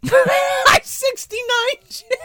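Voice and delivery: high, stretched-out squeal through laughter; slow, high, giggling echo; laughing mockery. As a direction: repeat it in a cracking-up high voice. Laughing repeat of misheard line.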